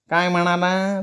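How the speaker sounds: high, hard-of-hearing elder; nasal old man. A narrator's reading. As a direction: ask in a high nasal old voice, not having heard. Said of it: "नाकात बोलणारा".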